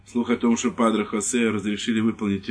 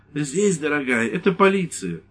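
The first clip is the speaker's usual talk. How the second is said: timid husband; 6 semitones higher than usual. Acted. Priest answers wife.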